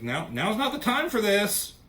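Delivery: urgent high-pitched interjection, acted out; Doctor Strange.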